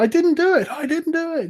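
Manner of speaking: high trembling cry of innocence; trembling yell. Acted. Terrified acolyte yelling his innocence at the brothers, high trembling cry. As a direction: quake and yell that you didn't do it. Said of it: "he's just yelling it".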